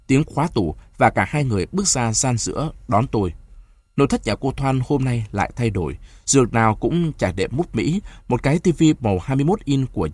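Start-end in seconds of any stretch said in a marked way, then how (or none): none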